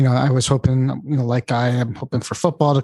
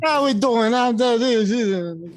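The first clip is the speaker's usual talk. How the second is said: joking fake Jamaican accent, much higher; mock Jamaican accent; 8 semitones higher than usual. Co-host joins the joke in a mock Jamaican accent. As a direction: play along with a silly, high put-on Jamaican voice. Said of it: "I thought we were in Jamaica".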